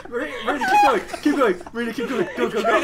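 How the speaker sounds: excited. Real, not acted. Urging friend to continue.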